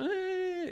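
very high, held squeal of interest; high drawn-out "eh". Retold or imitated. Ranger seeing the guns, high imitated "eh".

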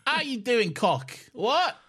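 high Cockney urchin voice; cheeky Victorian street kid; old london cockney. Pretend. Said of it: "Victorian street urchins".

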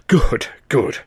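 breathless, relieved clerk; gasped relief. A narrator's reading. Clerk relieved the brother came back whole, gasping. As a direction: gasp it out in relief. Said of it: "the fellow gasped".

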